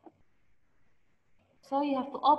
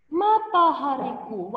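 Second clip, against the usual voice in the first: "Matahariku" starting high and dropping low; high-to-low intonation; 3 dB louder than usual. Shown as a demonstration. Teacher demonstrates pitch in poetry, "Matahariku" high then low. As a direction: lift the start of "Matahariku", then let it fall. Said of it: "matanya tinggi".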